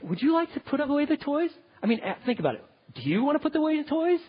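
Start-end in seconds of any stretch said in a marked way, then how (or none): 0.0-1.5 higher, coaxing question; mock pleading parent offering a choice; lightly voiced asking parent; asking permission. Shown as a demonstration. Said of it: "Don't say".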